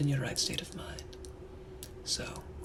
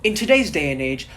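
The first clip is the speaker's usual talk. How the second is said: mock exasperated rant; 5 semitones higher, 6 dB louder than usual. Gentleman ranting about low standards.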